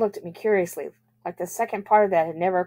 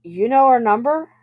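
puzzled question, higher and louder, rising; confused; 6 semitones higher, 6 dB louder than usual. A narrator's reading. Bobby asks Dad about his mother's number, confused. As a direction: ask it as if thrown by this. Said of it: "Bobby seemed confused".

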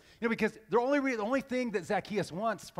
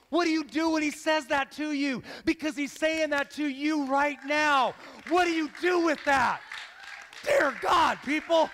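high, loud preacher's challenge; impassioned appeal; 7 semitones higher, 5 dB louder than usual. Real, not acted. Preacher urging people to respond to God, impassioned appeal. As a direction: press the crowd urgently, voice raised. Preacher presses the crowd urgently.

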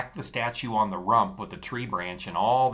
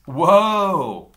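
loud, high startled whoa; 9 semitones higher, 14 dB louder than usual. Acted. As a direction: jump back with a loud whoa. Boy recoils from girl.

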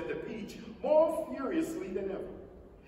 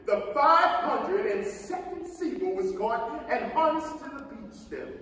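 ordinary delivery

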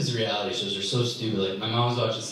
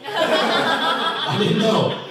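much higher, slow and louder, laughing through it; laughing emphasis; 7 dB louder, much slower than usual. Real, not acted. Comic repeats the admission to the crowd, laughing. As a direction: stretch it out laughing at yourself.

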